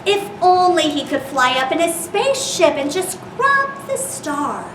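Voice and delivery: plain reading voice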